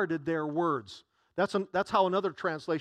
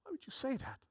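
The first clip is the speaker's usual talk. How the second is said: incredulous murmur; 5 semitones higher, 14 dB quieter, faster than usual. Retold or imitated. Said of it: "they were incredulous".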